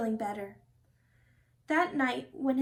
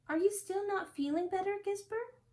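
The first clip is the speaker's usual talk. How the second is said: high, gentle worried question; worried parents; 7 semitones higher, 3 dB quieter than usual. A narrator's reading. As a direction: coax him softly. Parents worried for their son.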